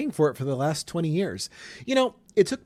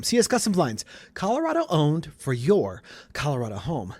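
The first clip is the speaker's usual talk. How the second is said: ad tagline; 3 semitones higher than usual. Acted. Host closing the sponsor ad with a punchy tagline.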